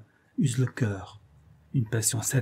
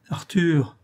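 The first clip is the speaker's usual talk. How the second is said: desperate call; 5 semitones higher, 4 dB louder than usual. A narrator's reading. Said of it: "lui dit-elle avec désespoir".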